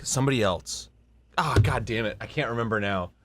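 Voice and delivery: usual speaking voice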